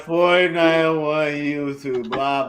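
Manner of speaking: mock the reluctant rankings reader; exaggerated reluctant readout, tailing into blah-blah; mocking imitation. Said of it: "like he didn't want to say it".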